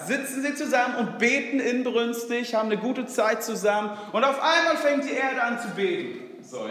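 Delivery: normal talking voice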